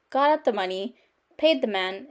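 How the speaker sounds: ordinary delivery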